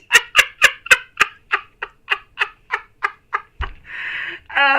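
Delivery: long high giggling laugh, fading out; amused laugh. Real, not acted. Reader laughing with a frustrated viewer about crochet, high giggle. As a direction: giggle high and let it trail away.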